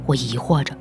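ordinary delivery, nothing marked

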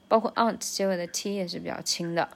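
usual speaking voice

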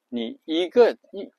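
normal talking voice

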